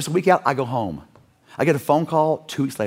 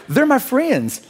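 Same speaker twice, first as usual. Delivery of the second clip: a much higher, laugh-filled answer; laughing reply; 10 semitones higher, 3 dB louder, much slower than usual. Retold or imitated. Preacher voicing Colton presenting his friends, laughing. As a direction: let the laugh break into the words.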